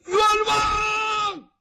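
sudden high, held shout; man hollering his grievance from outside; man's cry of injustice. Acted. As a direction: burst out calling for justice. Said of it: "有一个男生出来了".

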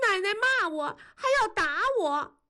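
put-on high tearful little girl; crying child. A narrator's reading. Girl crying to her mother about grandma, tearful. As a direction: whimper it like a hurt child. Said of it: "哭着说".

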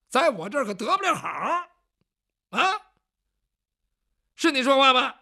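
rising to a loud threat, pitch climbing; stern loud warning. Acted. Man warns the haunted pot, loud and stern. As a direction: bark the threat at the pot to make it answer.

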